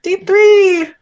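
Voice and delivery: high, loud, crying "Day three"; overcome, sobbing a toast; tearful toast. Acted. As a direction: choke out the toast through tears.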